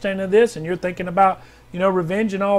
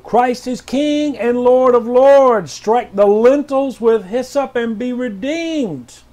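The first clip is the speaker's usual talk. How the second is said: higher, louder, commanding declaration; prophetic proclamation; 4 semitones higher, 4 dB louder, slower than usual. Acted. Pastor reading R J W's word, loud and commanding. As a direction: thunder it out like a prophet.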